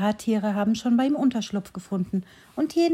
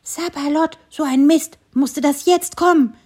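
high, louder little grumbling voice; annoyed squirrel voice; 6 semitones higher, 6 dB louder than usual. A narrator's reading. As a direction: grumble like a small cross squirrel. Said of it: "ein feines, aber ärgerliches Stimmchen". (feines, ärgerliches Eichhörnchenstimmchen, hoch und laut)

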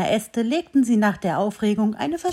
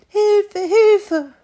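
very high, loud cries for help; a small fawn crying out for help; little voice crying help; 11 semitones higher, 7 dB louder than usual. A narrator's reading. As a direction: call out like a frightened fawn. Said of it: "ein Stimmchen".

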